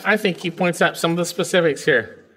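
normal talking voice

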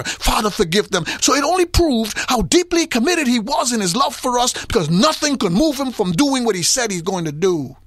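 preaching with mounting intensity; preaching at full force. Real, not acted. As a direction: build the fervor line by line. Preaching builds.

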